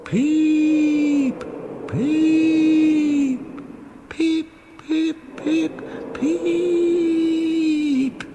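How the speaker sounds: very high, drawn-out ghostly peeps; shrieking whistle. Acted. Percy scares Thomas with shrieking whistle peeps. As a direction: shriek each peep like a haunted whistle. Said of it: "he shrieked".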